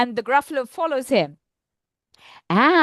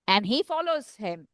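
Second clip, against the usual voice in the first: plain reading voice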